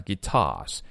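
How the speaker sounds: "guitars" with an open "ah" and silent r; no curled r. Shown as a demonstration. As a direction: drop the r in "guitars". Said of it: "是没有卷舌的".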